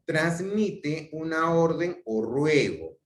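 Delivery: slow, high reading-out voice; reading aloud. Shown as a demonstration. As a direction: read the definition aloud, slowly.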